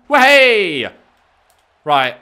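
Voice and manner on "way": excited cheer. Real, not acted. Move lands in match.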